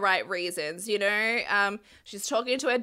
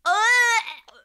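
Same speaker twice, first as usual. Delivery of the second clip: higher, strained disgust ending in a groan; 10 semitones higher, 3 dB louder, slower than usual. Real, not acted. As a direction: recoil as if smelling something rotten. Disgusted reaction to a vile remark.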